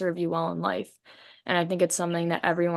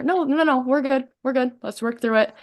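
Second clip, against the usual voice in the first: higher, gentle "no, no, no", calming someone down; soothing reassurance; 5 semitones higher, 3 dB louder than usual. Retold or imitated. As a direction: talk them back into the conversation. Calming someone who wants out.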